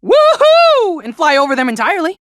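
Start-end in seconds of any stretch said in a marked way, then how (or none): 0.0-1.0 loud, high cheer of joy; excited whoop. Retold or imitated. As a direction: whoop it out like flying free. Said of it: "Or I could just go".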